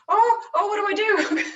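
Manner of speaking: high, loud flustered cry of what do I do; hammed-up panic, voice jumping up; mock panic. New pet owner facing a dead pet, mock panicked cry. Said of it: "they go".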